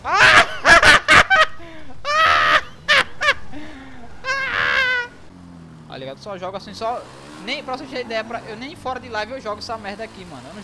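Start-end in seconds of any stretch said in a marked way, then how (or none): 0.5-5.8 high shrieking laugh; loud laugh. Real, not acted. Laughing after the fight.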